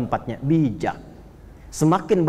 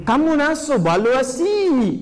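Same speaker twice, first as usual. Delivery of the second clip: slow, higher mock Bangladeshi phrase; Bangladeshi speech; 7 semitones higher, much slower than usual. Preacher imitating Bangladeshi speech, slow and higher mock accent. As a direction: stretch it out like a Bangladeshi speaker.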